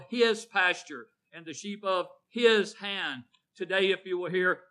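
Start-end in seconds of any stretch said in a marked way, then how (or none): 0.0-3.2 each HIS hit loud; preacher's heavy emphasis on HIS; stress on HIS. Real, not acted. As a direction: lean hard on every "his". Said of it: "putting this emphasis on the word his".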